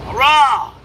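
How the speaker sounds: loud high roar; big playful lion roar. Shown as a demonstration. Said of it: "Tiger, like a lion".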